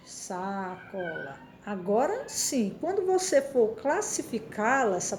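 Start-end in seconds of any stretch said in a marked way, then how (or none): 0.1-1.4 sacola drawn out slowly, quiet and unhurried. Shown as a demonstration. Teacher showing the class sacola said slowly. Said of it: "falar ela pausadamente".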